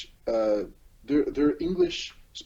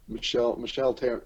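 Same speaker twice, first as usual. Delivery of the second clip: usual speaking voice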